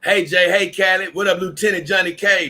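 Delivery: calling out hellos to the crowd; hearty greeting. Real, not acted. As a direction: hail each one loudly as they arrive. Greeting chatters by name.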